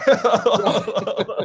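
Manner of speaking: loud burst of laughter; hearty laugh. Real, not acted. Laughing at his own story.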